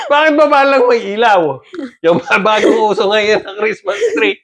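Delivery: high-pitched, laughing teasing; amused disbelief. Real, not acted. Parent ribbing child about the Christmas tree, laughing. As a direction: tease through real laughter.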